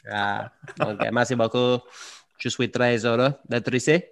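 put-on Spanish-accented French thank-you; clumsy Spanish speaker trying French. Joining the Rafa impression with a Spanish-accented speech. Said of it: "it's so Spanish".

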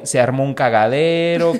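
normal talking voice

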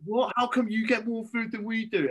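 indignant groupmates. Retold or imitated. Groupmates challenging him over his extra food, mock indignant. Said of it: "they were like".

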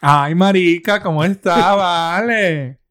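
loud Venezuelan Spanish greeting, with a laugh at the end; Venezuelan accent. Shown as a demonstration. Greeting Venezuelan listeners in a loud Venezuelan accent. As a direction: greet them like a Caracas friend. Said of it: "to our Venezuelan listeners".

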